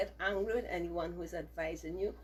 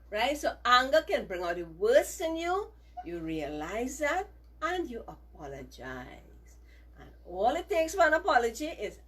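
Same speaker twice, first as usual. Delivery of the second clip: ordinary delivery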